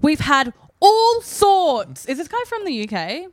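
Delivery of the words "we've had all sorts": drawn out, higher, louder voice; slow put-on voice. Reading the magazine to friends in a put-on voice. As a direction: ham up a put-on reader voice. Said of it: "Do the voice".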